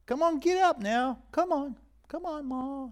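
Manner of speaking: a lightly voiced pleading son; mock pleading.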